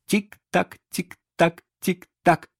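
high, evenly spaced tick-tock; distinct ticking. A narrator's reading. The ticking crocodile coming closer, distinct high tick-tock. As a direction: imitate a clock, each tick separate. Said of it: "вот уже звучит совсем отчётливо".

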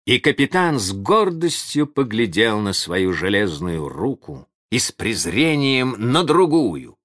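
ordinary delivery, nothing marked

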